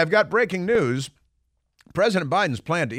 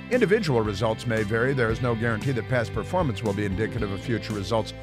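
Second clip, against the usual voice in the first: fast legal disclaimer; faster than usual. Acted.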